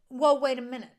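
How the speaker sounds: loud mock double take; alarmed. Husband hearing his wife mention a baby room, alarmed. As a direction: blurt it out to halt her.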